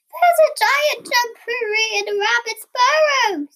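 high-pitched panicky cry; alarmed. A narrator's reading. Frightened Cat tells Bear about the intruder, high alarmed squeal. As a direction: blurt it out high as a scared cat.

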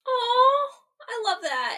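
almost crying, deeply moved; touched, teary. Real, not acted. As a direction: voice cracking with emotion. Touched by ghost cameo.

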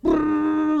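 high revving engine noise; engine brrr. Retold or imitated. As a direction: rev like a car.

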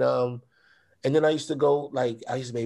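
normal talking voice

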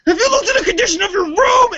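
high, strained mock-dad yell; dad laying into him. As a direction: imitate an outraged parent shouting a scolding. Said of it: "starts to lay into me".

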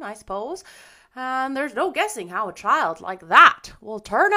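loud, emphatic foreboding; gloomy busybody piling on doubts. A narrator's reading. Rachel doubts Marilla can handle a child, gloomy. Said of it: "said the lady gloomily".

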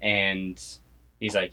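usual speaking voice

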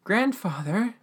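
high, hopeful rising question; acted emotional query. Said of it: "things get super emotional".

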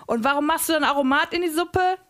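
interrogating shout; chef yelling. A narrator's reading. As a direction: snap the question.

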